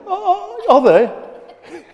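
high, clueless "oh, are they?"; mock puzzled surprise. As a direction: play the churchgoer who doesn't get it. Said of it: "we're all going".

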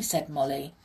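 ordinary delivery

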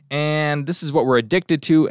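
ordinary talk

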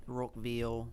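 put-on low Australian accent try; attempted Australian accent. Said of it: "I wanna do that".

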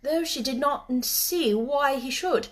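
ordinary delivery, nothing marked

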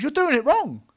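high, loud incredulous scolding. Real, not acted. Telling an imagined goalkeeper about the thumbs, incredulous scolding. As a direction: exclaim it in outraged disbelief.

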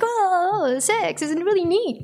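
the wrong, too-high take; high brainy voice. Shown as a demonstration. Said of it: "instead of".

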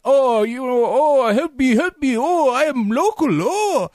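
imitating the prisoner begging Bond, hammed-up pleading; mock pleading prisoner. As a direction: ham up a helpless captive begging. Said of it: "He's like".

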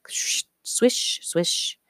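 whispery, drawn-out swish of stockings; swishing sound. A narrator's reading. Woman's legs passing the hidden girl, whispered swishes.

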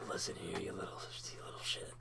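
whispery, low pretend menace; hushed mock threat. Co-host replays the threat to a friend, whispered mock menace. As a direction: threaten under your breath, playfully.

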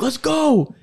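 high, whooping retold celebration; excited cheer. Fan calling Zach after a big win, excited cheer.

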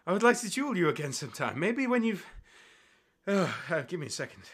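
winded, pausing for air; out of breath. Acted. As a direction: talk while catching your breath. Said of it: "you see he's kind of out of breath".

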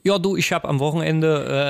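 demonstrating a Saxon dialect; Saxon accent. Shown as a demonstration. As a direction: have a go at Saxon on request. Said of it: "dieses Sechselnde. Ich würd's jetzt, kannst du das?". (Sächsisch, auf Zuruf vorgemacht)

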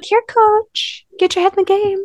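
high, playful call to a dog; mock dog-calling voice. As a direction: call your dog across the park, hammed-up. Calling dog named Coach Bolton.

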